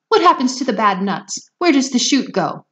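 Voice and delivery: high, loud anxious questions; mother shrieking at the chocolate maker. Acted. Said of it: "shrieked Missus Salt".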